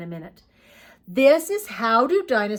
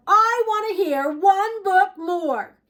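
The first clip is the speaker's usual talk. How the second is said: higher, louder and slow, a hammed-up whiny shout; mock shout; 10 semitones higher, 6 dB louder, slower than usual. Dinosaur demands one more bedtime book, hammed-up shout.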